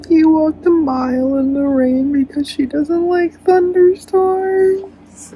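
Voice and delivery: slow, high, loud wail; overwhelmed wail. Real, not acted. Reader overcome by the hero's devotion, loud drawn-out wail.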